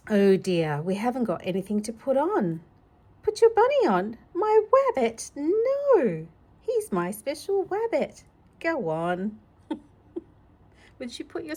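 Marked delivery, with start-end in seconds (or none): 3.2-8.2 put-on high childish pleading; little child voice. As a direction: protect the beloved bunny like a toddler. Child refusing to give bunny.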